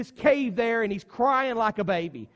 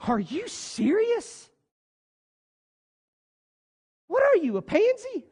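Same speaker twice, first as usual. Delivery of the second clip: mocking disbelief; 8 semitones higher than usual. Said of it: "And then we would say".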